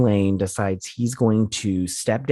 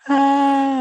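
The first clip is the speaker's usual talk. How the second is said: delighted squeal; 5 dB louder than usual. Real, not acted. Laughing at the silly line.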